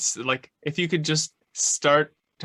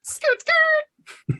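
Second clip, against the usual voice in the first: squeaky skirt-skirt; 4 dB louder than usual. Pretend. Silly sound effect quip.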